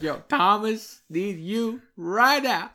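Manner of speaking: loud, drawn-out urgent call; loud slow call. Retold or imitated. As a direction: call out slowly and loudly like a mother in need. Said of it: "She'd be like".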